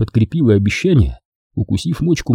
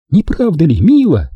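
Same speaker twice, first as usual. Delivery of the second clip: put-on higher young woman's voice, teasing; Дженни; 7 semitones higher, 3 dB louder than usual. A narrator's reading. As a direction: play the girlfriend, sweet and teasing. Jenny voiced. (нежная игривая Дженни)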